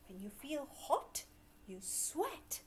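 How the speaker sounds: ordinary talk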